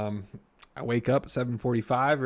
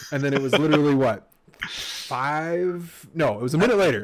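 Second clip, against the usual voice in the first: usual speaking voice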